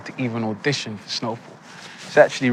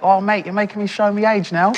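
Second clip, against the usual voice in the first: imitating Beckham; 9 semitones higher than usual. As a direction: put on David Beckham's chatty footballer voice. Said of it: "And he was like".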